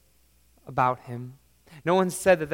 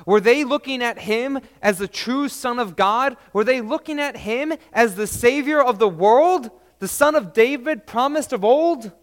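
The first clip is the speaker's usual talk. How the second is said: ringing, higher, louder rhetorical questions; preaching at full force; 7 semitones higher, 8 dB louder than usual. Acted. Preacher pressing the congregation, at full force.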